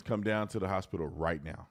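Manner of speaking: ordinary talk, nothing marked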